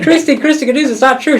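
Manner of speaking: high, loud disbelieving shriek of what; wife hearing the news, shocked high loud yelp. Retold or imitated.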